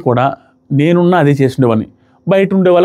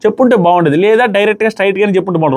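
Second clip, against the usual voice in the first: ordinary talk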